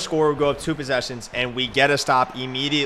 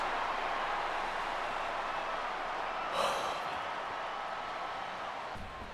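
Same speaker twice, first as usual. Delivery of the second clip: drawn-out exasperated exhale; long weary sigh; 12 dB quieter than usual. Real, not acted. Gamer worn down by opponent's luck, long weary sigh. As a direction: let all the air out in defeat.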